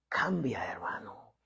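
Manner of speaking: soft, whispered urging; hushed appeal. Real, not acted. Preacher urging a brother to change, hushed appeal. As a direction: drop to a near-whisper.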